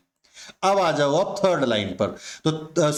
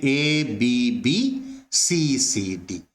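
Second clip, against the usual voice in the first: एबीबीसीसीडी read out letter by letter, very slowly; teacher dictating एबीबीसीसीडी letter by letter, very slowly; slowly spelled out; 4 semitones lower, much slower than usual. Shown as a demonstration. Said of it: "आपके rhyming scheme बन गई".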